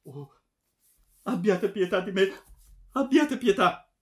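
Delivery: very high, loud begging; frantic plea. A narrator's reading. Daughter begging her father for pity, frantic. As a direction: wail it out, imploring for mercy.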